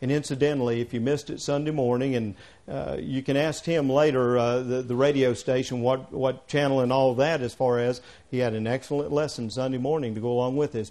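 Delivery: ordinary talk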